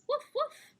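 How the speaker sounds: high, loud puppy woof; puppy bark. Acted. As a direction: yap like a small dog. The puppy barks in the story.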